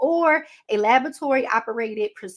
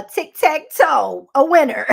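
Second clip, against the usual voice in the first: viral lady quote; 4 semitones higher, 8 dB louder than usual. Retold or imitated. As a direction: stretch out tic-tac-toe like the viral clip. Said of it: "you know what that lady said that's been going viral".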